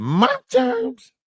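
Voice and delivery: very high, squeaky imitation of her; squeaky mock Maggie. Comic mocks Maggie's high squeaky claim. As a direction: squeak it out like a cartoon kid. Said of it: "Maggie said".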